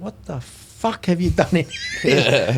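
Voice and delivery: slow, drawn-out outraged demand; a shocked father storming into his changed shop; dad swearing in Greek. Retold or imitated. Father walks in and sees the shop changed, slow outraged demand. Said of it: "he says in Greek".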